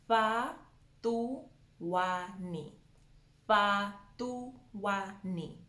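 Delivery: "Patuani" in widely separated, slow syllables; correct Venda breakdown. Shown as a demonstration. Teacher breaking down the name, slow separated syllables. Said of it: "it's a th sound".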